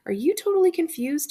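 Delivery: a dad amused by his confused son; puzzled dad. A narrator's reading. Dad voiced questioning son.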